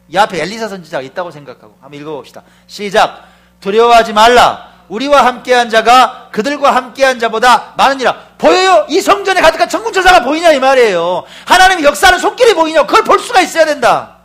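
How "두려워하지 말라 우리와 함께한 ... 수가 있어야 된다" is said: loud, high, proclaiming shout; shouted scripture reading. Acted. Preacher leading the church in reading, shouting high and loud. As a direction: thunder the verse out to the church.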